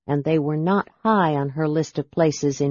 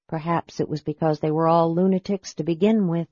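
usual speaking voice

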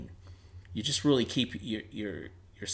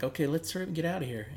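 hurried nervous; 6 semitones higher, faster than usual. Retold or imitated. Nervous urge to leave woods.

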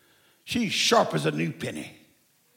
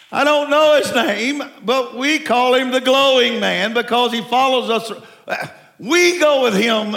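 preaching at full force; 4 semitones higher, 5 dB louder than usual. Acted. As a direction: proclaim it to the whole congregation. Preaching about the glowing man.

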